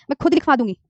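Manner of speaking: ordinary talk, nothing marked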